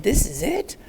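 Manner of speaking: a touch of incredulous wonder; retold disbelief. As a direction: recall your own surprise, lightly acted. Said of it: "and I said".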